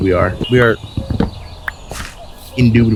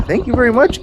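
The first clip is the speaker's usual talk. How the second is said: excited thanks; 13 semitones higher than usual. Real, not acted. Excited at finding iron.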